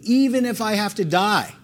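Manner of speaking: high-pitched, emphatic vow; emphatic insistence. Acted. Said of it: "insisted emphatically".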